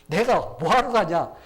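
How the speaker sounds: loud, drawn-out, indignant retort; defiant refusal. Acted. Queen refusing the king's summons, defiant.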